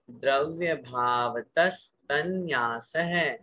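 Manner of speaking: slow, syllable-by-syllable chanting of the sutra; slow sutra recitation. Shown as a demonstration. Teacher reciting sutra phrases for students to repeat, slow. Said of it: "इसलिए बाहर से उच्चारण करें".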